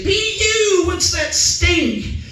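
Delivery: put-on very high, slow taunting kid voice; mocking kids. Schoolkids teasing him about his name, high mocking taunt. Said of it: "Kids would make fun of me".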